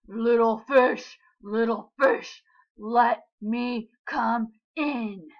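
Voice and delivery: loud, slow menacing shark; big bad shark. A narrator's reading. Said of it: "roared the shark".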